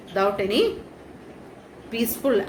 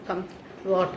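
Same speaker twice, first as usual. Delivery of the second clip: ordinary delivery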